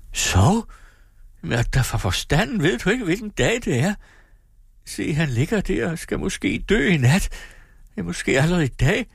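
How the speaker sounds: horrified she could sleep now; alarmed mother. Acted. Mother to daughter, shocked and distraught, high.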